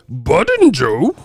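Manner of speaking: slow, drawn-out silly voice; exaggerated reading of a jumbled name; mocking imitation. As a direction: stretch each word in mockery. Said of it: "That motherfucker said".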